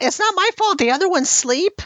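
high-pitched whining goblin protest; a goblin squealing that it's not his fault; whiny goblin. Acted. GM redoes line as goblin.

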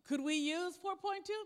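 half-joking, boosting the voice on the money; playful loud aside. Real, not acted.

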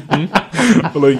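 normal talking voice

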